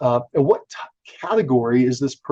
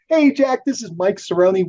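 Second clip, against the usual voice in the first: higher, upbeat hello by name; friendly phone greeting; 7 semitones higher than usual. Shown as a demonstration. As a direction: greet the caller by name like an eager salesman. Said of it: "And I say".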